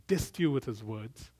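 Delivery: ordinary delivery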